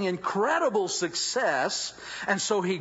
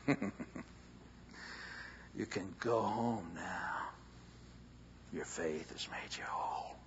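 tender blessing; 9 semitones lower, 12 dB quieter than usual. Acted. Jesus gently sending the healed woman home in a whisper.

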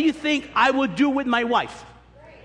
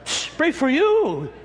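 higher, scoffing pastor's comeback; indignant retort; 6 semitones higher, 3 dB louder, slower than usual. Acted. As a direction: throw it back in mock disbelief. Pastor scolding the deserted husband.

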